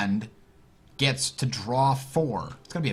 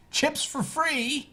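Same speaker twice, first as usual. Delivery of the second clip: excited callout; much slower than usual. Real, not acted.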